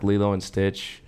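ordinary talk, nothing marked

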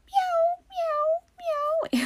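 imitating the cats meowing along, very high. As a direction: mew like the cats answering.

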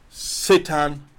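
ordinary talk, nothing marked

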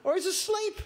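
high-pitched sarcastic taunting; mocking. Elijah mocking the prophets of Baal about their silent god. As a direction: tease them with exaggerated, climbing suggestions. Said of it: "Elijah began mocking them".

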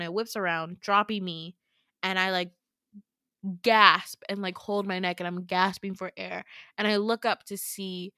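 ordinary talk

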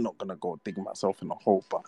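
ordinary talk

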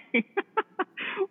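imitated laugh. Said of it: "that laugh that is so recognizable".

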